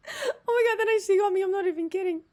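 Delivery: real crying laughter, pitch up; helpless laugh-crying breaking through the words; laughing to tears. Real, not acted. Streamer cracks up at the bean scene, laughing to tears. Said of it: "I'm actually crying".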